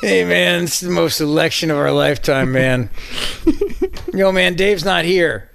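drawn-out, spaced-out stoner voice; lazy stoner impression, words stretched; Cheech and Chong stoner. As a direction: play a stoned guy from a comedy movie. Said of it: "like watching a Cheech and Chong movie".

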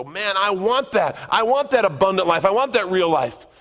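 high, loud, yearning "I want that"; eager longing. Retold or imitated. Churchgoer reading promises, eager and loud. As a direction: reach for it like a hungry believer. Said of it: "We read the promises in Scripture and go".

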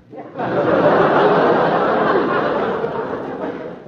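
high unvoiced jittery laugh; a high nervous cackle. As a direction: laugh off creeping fear. Husband laughs nervously to himself.

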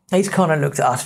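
plain reading voice

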